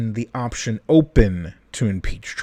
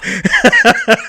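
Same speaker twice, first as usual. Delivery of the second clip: high laugh; 11 semitones higher, 6 dB louder than usual. Real, not acted. Laughing at own voice.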